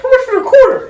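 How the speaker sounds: high loud laughing squeak; Gary Vee impression. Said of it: "he was like".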